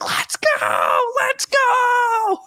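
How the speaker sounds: cheering at midnight with parents asleep, then breaking into a high shout; whisper-shout cheer. Retold or imitated. Fan cheering a home run while parents sleep, rising to a high shout. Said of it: "I was doing, like, the whisper".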